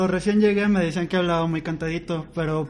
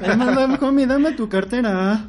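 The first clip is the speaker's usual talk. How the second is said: mock mugger; 5 semitones higher, 4 dB louder, slower than usual. Jokingly playing a mugger.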